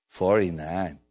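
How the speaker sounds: put-on low, light Irish accent; attempted Texan slipping into Irish; a hint of Irish lilt; accidental Irish accent. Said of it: "That's a good Irish accent coming out of me there".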